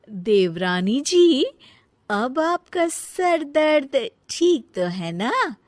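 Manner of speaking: a mocking laugh while flaunting bangles to the rival; gloating mock. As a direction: rub it in with a smug laugh. Elder one gloats over bangles.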